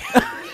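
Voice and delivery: high helpless laugh; cracking up loudly; burst of laughter. Real, not acted. Partner mocks sexy line.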